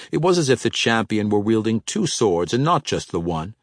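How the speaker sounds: usual speaking voice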